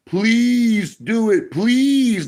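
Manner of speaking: high, slow, whiny mock plea; mock begging. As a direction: parody someone pleading on their knees. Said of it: "on my hands and my knees crying".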